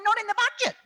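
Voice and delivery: mock the refusal while laughing; incredulous, laughing "Not in the budget"; mocking laughing quote.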